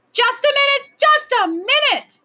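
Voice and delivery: loud, very high drawn-out shout. A narrator's reading. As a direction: holler to silence a noisy room. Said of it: "shouted the Wayne Doodle".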